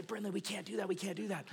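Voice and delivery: very soft, urgent parental hush; hushed reproof. Retold or imitated. Dad to daughter grabbing a stranger's popcorn, hushed reproof. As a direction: keep it down, as if scolding in a theater. Dad hushing child in theater.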